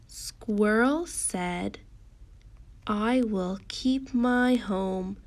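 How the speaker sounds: very slow, deliberate storybook reading; teacher reading aloud word by word; slow read-along. Shown as a demonstration. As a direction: stretch the words so children can follow.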